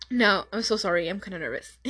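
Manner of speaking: usual speaking voice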